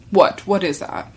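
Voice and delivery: usual speaking voice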